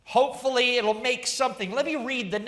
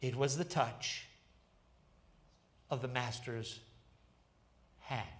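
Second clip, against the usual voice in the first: much softer and lower, a quiet reveal; said with a smile; 8 semitones lower, 8 dB quieter than usual. Acted. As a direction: let it sink in gently with a smile. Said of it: "he said with a smile".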